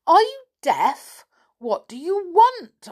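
high, loud, slow and cross rabbit voice. A narrator's reading. Rabbit impatient with staring children, cross furry voice. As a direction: snap at the children like an annoyed rabbit. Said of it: "spoke again rather crossly".

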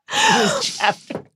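very high, slow laughing words; laughing squeal. Real, not acted.

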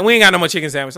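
loud, brash cashier yell. Retold or imitated. Customer imitates the KFC worker yelling at him, loud. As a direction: bark it like the annoyed cashier. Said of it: "It's like".

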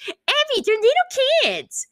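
very high, squeaky mocking parrot. Coach throwing a mom's words back at her, mocking. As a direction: mimic it back in a silly high voice. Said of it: "And Abby mocks her".

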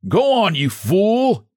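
slow, high, angry yell; high, slow shouted insult. Acted. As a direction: bark it at the man below, pitched high. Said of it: "he shouted".

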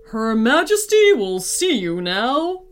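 lightly voiced palace guard; guard announcing. A narrator's reading. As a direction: formally usher visitors in to royalty.

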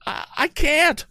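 very high, slow stammered woman's voice; frightened. A narrator's reading. The young lady answering Tom, frightened high voice. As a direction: stammer it out in fear. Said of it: "she answered frightenedly".

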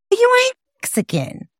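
put-on very high-pitched kid's denial; mimicked schoolkid taunt. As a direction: imitate a third grader's put-down. Schoolchildren denying her identity, voiced.

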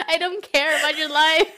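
said through real laughter; laughing. Real, not acted. Laughing at her own rant about the supervisor. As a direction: giggle your way through the line.

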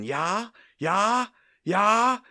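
high, loud imitation German cries; mock German ranting. Mo ending his gibberish speech, mock German shouts. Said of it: "a bunch of German-sounding".

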